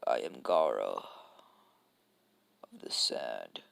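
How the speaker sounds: very low, slowing menacing introduction; Gaara. Acted. Offended kid introduces himself to Naruto, low and ominous. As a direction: sink down low and draw out the title. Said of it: "Gaara impression".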